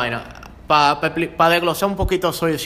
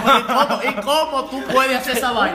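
high, laughing disbelief; amazed, laughing; 8 semitones higher than usual. Retold or imitated. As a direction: ask it in giddy amazement. Kid amazed watching Rey Misterio.

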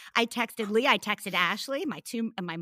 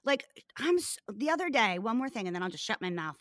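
ordinary delivery

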